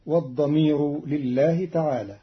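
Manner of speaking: usual speaking voice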